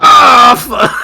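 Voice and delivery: loud, high anguished cry; pained yell. Retold or imitated. Reacting to a creepy clip, loud anguished cry. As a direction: cry out in disgust. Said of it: "You're like".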